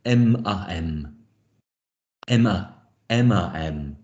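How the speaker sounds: "M, A, M" blended from letter-name ems, em-uh-em; laboured blending of "M, A, M" with em for M; wrongly blended sounds. Shown as a demonstration. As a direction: run em and uh together into "M, A, M". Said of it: "blending that would give you".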